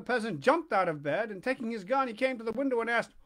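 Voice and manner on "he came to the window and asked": ordinary delivery, nothing marked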